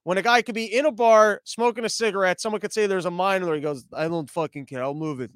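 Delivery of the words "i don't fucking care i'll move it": lightly voiced low, soft unbothered smoker; a hint of a nonchalant bar tough; imitating unfazed tough guy. Man in a bar shrugging off a mine, lightly imitated nonchalance. Voicing unbothered smoking Ukrainian.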